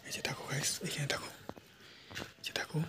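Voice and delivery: soft sneaky whisper. Acted. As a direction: whisper as if spying. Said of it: "আস্তে কথা বলছি।".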